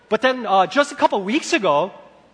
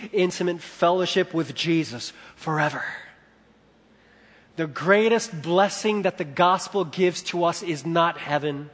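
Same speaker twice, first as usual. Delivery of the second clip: ordinary delivery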